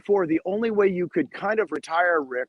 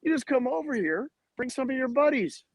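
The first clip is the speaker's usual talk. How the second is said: much higher, eager sell; pitching the idea to agents with excitement; excited pitch; 7 semitones higher than usual. Retold or imitated. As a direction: hook them with the big reveal. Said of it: "I go".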